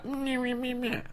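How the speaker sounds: high, soft, dismissive meh meh; mocking blah blah. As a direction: mumble it like a bored kid.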